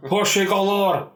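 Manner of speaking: high, slow Scottish drawl of Porsche galore; hammed-up Sean Connery exclaiming Porsche galore; Sean Connery impression. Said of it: "like Sean Connery just a little bit".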